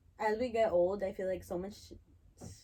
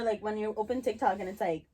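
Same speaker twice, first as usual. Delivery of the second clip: ordinary talk, nothing marked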